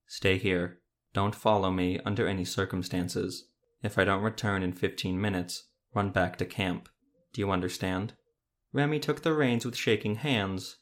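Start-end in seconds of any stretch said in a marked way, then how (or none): none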